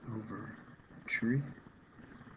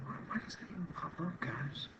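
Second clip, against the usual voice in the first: higher, frustrated whispered complaint; annoyed whisper; 7 semitones higher, 3 dB quieter than usual. Real, not acted. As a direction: whisper it, fed up with the pop-up. Pop-up annoyance.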